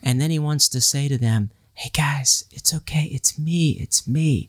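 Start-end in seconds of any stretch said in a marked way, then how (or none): 1.7-4.5 breathy comfort to frightened friends; reassuring Jesus. Retold or imitated. Jesus voiced reassuring disciples in storm.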